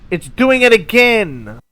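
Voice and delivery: loud, high, slowed outburst; a real exasperated cry; frustrated yell. Real, not acted. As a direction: shout it in disbelief. Rocket flips again.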